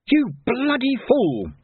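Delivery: high, slow, outraged curse; angry outburst. A narrator's reading. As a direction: curse your captor in high-pitched outrage. Seized man swears at his captor.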